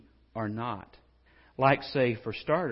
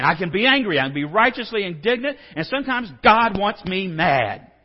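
self-justifying anger; 6 semitones higher, 10 dB louder than usual. Retold or imitated. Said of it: "So then we start saying".

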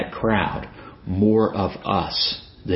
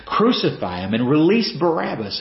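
high-pitched mock crowd cry; retold crowd shout; 7 semitones higher than usual. Preacher voicing the crowd joining in, mild mock shout. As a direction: imitate a shouted demand without full volume. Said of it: "getting people to shout with them".

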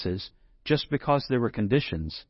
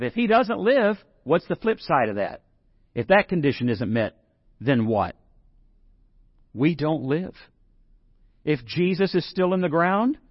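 usual speaking voice

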